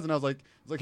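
usual speaking voice

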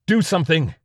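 high, loud panicked screams; an officer screaming in panic. Acted. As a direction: scream orders at anyone. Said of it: "The hazmat man screamed".

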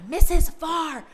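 put-on excited kid voice dropping to a whisper on the word; tattling child, loud then whispering. Kids reporting a bad word to the teacher, hushed at the end. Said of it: "they come to me and they are".

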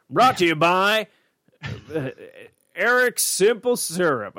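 high, slow mock sponsor read; sponsor announcer.

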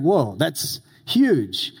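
slow, drawn-out wonder; mock awe. Villagers picturing a giant hut, in slow mock awe. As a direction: savor the whoa like a wide-eyed listener.

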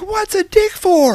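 high, drawn-out mock scream; retold scream. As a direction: stretch it out in a high imitation yell. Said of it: "Like, really loud".

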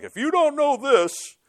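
high, slow, pompous lecturing drawl; mock professor voice. Preacher mocking his old professors with a lofty voice. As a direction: lampoon a self-important professor.

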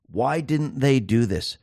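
ordinary talk, nothing marked

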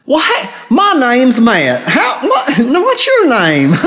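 hammed-up high hillbilly drawl; country person accent. Shown as a demonstration. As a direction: do an over-the-top high country bumpkin accent. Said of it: "like, a plain country person accent".

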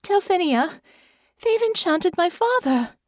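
put-on frightened young girl with a shaking voice. A narrator's reading. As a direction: tremble as you tell her. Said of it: "her voice shaking".

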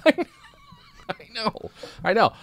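laughing while agreeing. Real, not acted.

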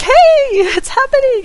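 high, loud thrilled call; excited cheer. Retold or imitated. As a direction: cheer the small win. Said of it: "I'm like".

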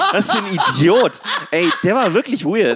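laughing through the words; giggling in disbelief. Real, not acted. Lacht über den Betrunkenen. (lachend, ungläubig)

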